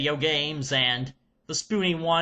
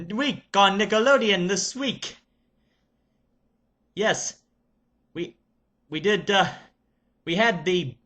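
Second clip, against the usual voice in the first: put-on Serbian accent. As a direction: play an excited Serbian reviewer character. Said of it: "I shall get into character".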